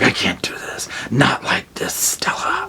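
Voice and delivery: breathless whisper, barely a sound. Retold or imitated. Husband whispering to Stella, can't go on, in a whisper. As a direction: hush it like a man at his limit.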